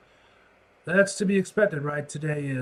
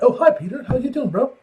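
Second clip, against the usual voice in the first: higher, lightly mocking Peter's limp hello; a hint of a timid Peter; mock meek greeting; 8 semitones higher, 4 dB louder than usual. Mock version of Peter's limp hello to his boss. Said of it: "And like".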